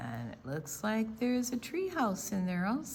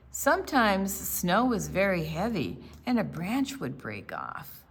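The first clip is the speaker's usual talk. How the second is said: clear, steady storybook reading, a bit louder; story narration; 6 dB louder than usual. A narrator's reading. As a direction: read the picture book to the class. Read-aloud story narration.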